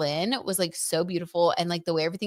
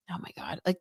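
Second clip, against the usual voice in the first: hushed, embarrassed whisper; 9 dB quieter, faster than usual. Real, not acted. Whispering to viewers before an awkward remark. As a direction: drop to a breathy secret before saying something awkward. Embarrassed aside about dating money.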